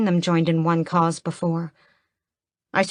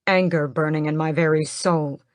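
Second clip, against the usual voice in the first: plain reading voice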